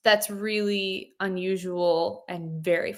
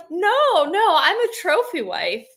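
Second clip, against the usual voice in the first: cheeky boast; 8 semitones higher, 5 dB louder than usual. Retold or imitated. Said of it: "And I'm like".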